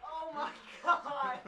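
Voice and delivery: breathless after a laughing fit; quiet, slow, spent from laughing; after laughing hard. Real, not acted.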